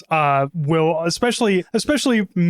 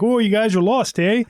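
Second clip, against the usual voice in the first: imitating a Canadian local, higher-pitched; hammed-up Canadian accent with a tagged-on "eh"; mock Canadian, lilting up high; super Canadian; 5 semitones higher than usual. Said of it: "super Canadian".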